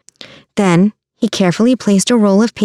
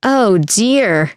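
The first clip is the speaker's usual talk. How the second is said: ordinary delivery, nothing marked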